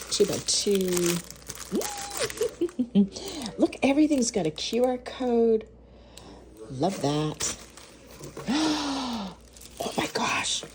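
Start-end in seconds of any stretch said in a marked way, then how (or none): 1.2-3.5 high, soft squeal into giggles; giddy giggle. Real, not acted. Giddy over the packed goods. 8.2-9.3 high, soft gasp; delighted gasp. Real, not acted. Sees the next loaf.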